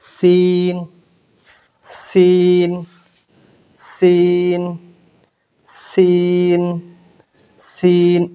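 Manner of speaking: সিন with a sharp hissed s; clear সিন. Shown as a demonstration. Teacher modelling সিন for students. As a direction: hiss the s of সিন cleanly. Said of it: "এই সিনে নোক্তা নাই".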